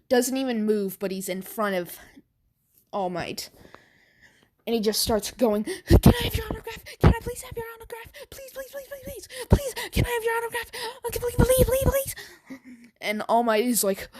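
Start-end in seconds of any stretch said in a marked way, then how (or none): 5.6-12.1 very high, fast, giddy pleading; squeaky high rapid-fire begging; fanboy begging. Acted. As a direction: gush like a starstruck fan.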